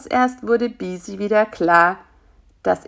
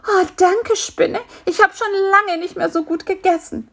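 high, contented fly voice; grateful fly; 12 semitones higher than usual. Acted. Fly thanks spider after the feast, grateful. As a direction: thank your host as the well-fed fly.

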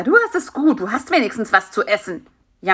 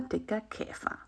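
ordinary delivery

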